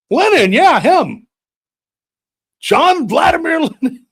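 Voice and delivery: ranting grandpa. Retold or imitated. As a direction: shout it like a confused furious grandpa.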